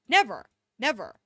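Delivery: sharp, loud shocked cry of Never!; gasped denial. A narrator's reading. Narrator voicing a boy gasping in shock. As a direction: blurt it out in disbelief. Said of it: "he gasped unsurely".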